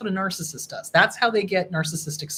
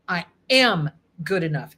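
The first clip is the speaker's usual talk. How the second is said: usual speaking voice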